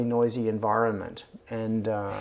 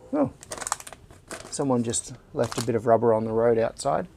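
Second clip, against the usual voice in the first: ordinary talk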